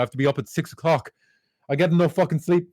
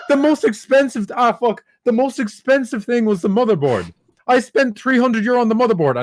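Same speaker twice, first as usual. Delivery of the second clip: high, worked-up venting; indignant, comically aggrieved tirade; exasperated rant; 6 semitones higher, 3 dB louder than usual. Real, not acted. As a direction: rant about the money with raised pitch. Comic rant about wasted money.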